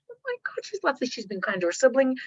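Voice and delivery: high, breathy soft cooing; mock gushing relatives. Family at the dinner table gushing over the toddler softly. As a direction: coo like an admiring table.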